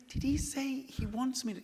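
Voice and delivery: high, unsure question; high, bewildered asking; disbelieving. Retold or imitated. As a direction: ask in disbelief. Retold disbelief.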